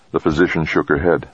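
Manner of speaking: ordinary delivery, nothing marked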